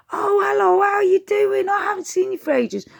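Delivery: overjoyed to see a long-lost relative; gushing greeting. Retold or imitated. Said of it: "people greet the family members".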